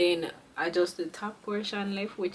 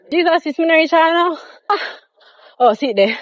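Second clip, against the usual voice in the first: exasperated; 6 semitones higher, 11 dB louder than usual. Real, not acted. Out of breath, exasperated.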